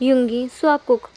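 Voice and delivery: usual speaking voice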